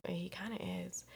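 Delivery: whispered, half-guilty aside; hushed admission. Retold or imitated. Whispered to a friend, admitting the ex is hot. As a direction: breathe it out like a secret confession.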